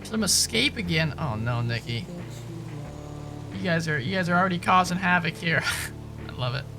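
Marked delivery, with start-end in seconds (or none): none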